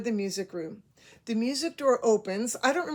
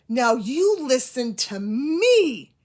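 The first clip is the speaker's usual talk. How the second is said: slow, louder, a hint of a stern teacher; stern substitute, voice going up; 3 semitones higher, 7 dB louder, much slower than usual. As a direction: lightly mimic a scolding substitute teacher, drawn out. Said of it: "her voice kinda went up".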